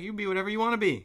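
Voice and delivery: rushed, high, bubbly encouragement; fast high cheerleader voice. Retold or imitated. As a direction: gush it like a cheerleader.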